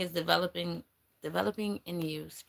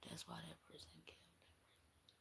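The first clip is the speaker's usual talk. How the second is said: whispered like a secret; 27 dB quieter than usual. Real, not acted. Reader confiding the murder motive to the viewer, whispering. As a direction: breathe it out with no voice at all.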